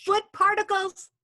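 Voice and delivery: high, slow disbelief; incredulous. Real, not acted. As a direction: repeat it in disbelief. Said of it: "incredulous echo".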